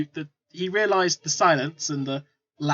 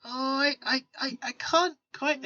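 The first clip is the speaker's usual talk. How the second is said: higher, softer, stammered hedging; reluctant stalling; 8 semitones higher, 5 dB quieter than usual. Acted. Trader stalls customers for a bribe, reluctant and halting. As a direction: draw it out haltingly, as if unable to say.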